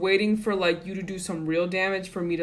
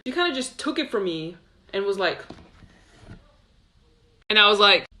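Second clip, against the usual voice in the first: ordinary talk, nothing marked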